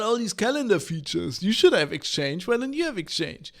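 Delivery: bragging CEO at the drinks club, pitched high; smug CEO. Retold or imitated. CEO bragging to other CEOs over drinks, high and boastful. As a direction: show off the new Exchange like a proud executive. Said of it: "And then they're like".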